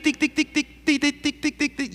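high, quick tic tic tic like a telegraph key; light clicking tic sounds; imitated telegraph ticking. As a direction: tap out Morse code with the voice. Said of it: "no escucharon ese tic".

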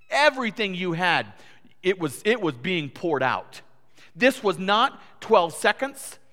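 loud, high, emphatic sermon voice; forceful preaching. Real, not acted. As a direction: hammer each phrase home from the pulpit.

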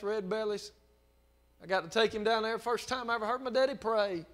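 usual speaking voice

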